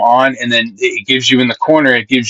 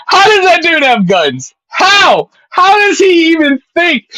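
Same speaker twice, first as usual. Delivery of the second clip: high, loud outraged rant; nearly crying out in disbelief; exasperated shouting; 4 dB louder than usual. Real, not acted. Ranting to chat about Derek's guns, loud exasperated shout.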